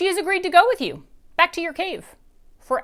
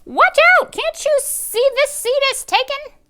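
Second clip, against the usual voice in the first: shout; 13 dB louder than usual. A narrator's reading. Dad sits on guest.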